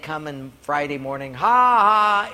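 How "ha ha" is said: loud, drawn-out, raised taunting "ha ha"; mock guard laugh. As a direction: imitate jeering guards with a big fake laugh. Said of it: "and they'd laugh".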